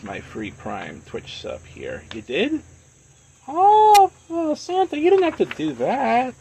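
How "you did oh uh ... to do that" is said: surprised. Real, not acted. Viewer gifts a sub unexpectedly.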